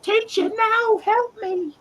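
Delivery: very high, pleading nerd squeal; Horshack whine. Retold or imitated. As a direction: beg the teacher for rescue. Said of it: "poor Horshack's like".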